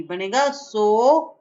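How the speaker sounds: सो stretched out slowly; slow model pronunciation. Shown as a demonstration. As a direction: draw out सो for the class. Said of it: "स पे ओ की मात्रा लगेगी".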